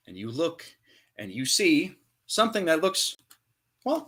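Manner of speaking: normal talking voice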